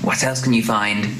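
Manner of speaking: usual speaking voice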